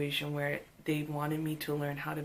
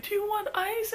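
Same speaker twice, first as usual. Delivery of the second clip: much higher, sugary helpful voice; kind flight attendant; 3 dB louder than usual. Retold or imitated. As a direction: offer the crying child some ice. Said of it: "was like".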